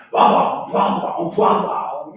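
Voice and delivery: loud mock bass thumps, rising high at the end; imitated loud bass. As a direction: boom it out like a blaring speaker. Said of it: "he's like".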